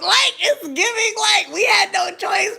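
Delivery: slow, very high, loud wail; mocking screech. Real, not acted. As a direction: stretch each word into a high, loud screech. Mocks the state's reluctance, yelling.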